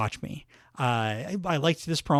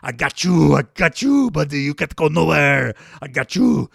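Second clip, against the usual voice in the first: wrestler taunt; 8 dB louder than usual. Retold or imitated. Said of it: "doing his".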